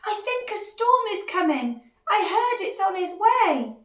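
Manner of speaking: high, loud cartoon alarm; squeaky shouting lizard. Lizard warns dinosaur a storm is coming, squeaky shout. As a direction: squeak out a warning at the top of the voice. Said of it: "shouting in a squeaky".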